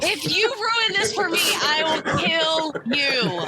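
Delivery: high, exasperated warning slowing at the end; mock threat. [name] warns accomplices in elevator, exasperated threat. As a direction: draw out the last words as a threat.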